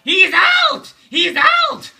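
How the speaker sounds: very high, loud bird call of words; telling-tale bird crying out; Miro bird calling. A narrator's reading. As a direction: cry it like the tattletale bird. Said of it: "Miro calling".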